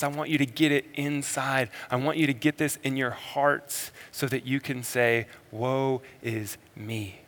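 Jesus speaking softly to the disciples; tender and soft. Retold or imitated. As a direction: draw them in gently, almost under the breath. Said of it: "now he speaks to the disciples".

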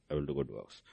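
a soft, breathy whisper of a sulking prophet; hushed, muttering the excuse under his breath. Acted. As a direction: whisper it like a lame excuse to yourself. Elijah's excuse voiced, mocking.

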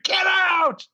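high, loud shrieked mock scream; banshee scream. Taylor waving a tire iron at Allison, shrieked scream. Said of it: "screams like a banshee".

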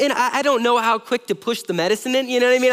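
ordinary talk

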